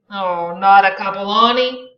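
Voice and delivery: loud sudden demand. Acted. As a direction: snap the question out loud. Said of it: "demanded Tom suddenly".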